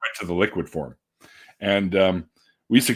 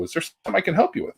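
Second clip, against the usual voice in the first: a quick, higher, chirpy clerk offering help; store clerk; 10 semitones higher, faster than usual. Retold or imitated. A store clerk greeting a browsing customer in a higher, quicker voice. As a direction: rattle off the clerk's stock greeting. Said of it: "the clerk or the attendant would come up and go".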